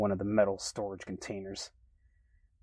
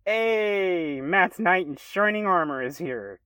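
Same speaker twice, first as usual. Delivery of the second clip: loud, high, gleeful greeting; excited shout; 10 semitones higher, 10 dB louder, slower than usual. Acted. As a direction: shout it out with delight. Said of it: "Joba shouted excitedly".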